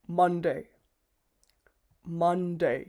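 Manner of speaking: মানডে said as man plus day, clear; মানডে modelled slowly, man-day; Monday demo. Shown as a demonstration. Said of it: "এখানে pronunciation trick হচ্ছে মান plus ডে".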